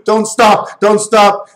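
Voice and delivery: high, urgent mock plea from a lover; wife in bed. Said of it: "in bed with your wife, you're going".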